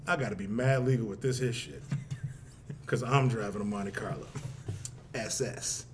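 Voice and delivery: very low, soft deadpan; low wary aside. Retold or imitated. Man sizing up his Monte Carlo, low wary aside. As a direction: drop right down, low and quiet.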